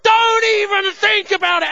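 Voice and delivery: high, hard shouted warning; loud re-enacted threat; East End gangster threat. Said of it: "turned into an East End gangster".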